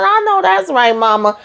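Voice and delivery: high, delighted "I know that's right"; excited cheer. Real, not acted. Host cheering mama's hair rule, high and delighted. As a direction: hype mama up like a friend.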